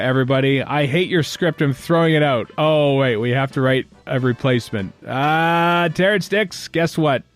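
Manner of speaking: ordinary delivery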